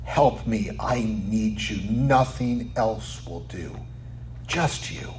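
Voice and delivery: low, slow, softened pleading; humble plea. Retold or imitated. Woman on her knees begging the Lord for help, hushed. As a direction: voice her surrender quietly, fading almost to nothing. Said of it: "She just says".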